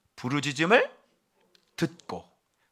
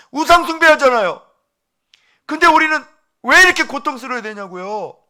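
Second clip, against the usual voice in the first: much higher and louder, a desperate cry; anguished protest; 11 semitones higher, 12 dB louder than usual. Acted. Weeping Egyptian youth confronting believers, crying out loud. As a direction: demand it through tears of outrage. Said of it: "이렇게 여러분들 따졌어요".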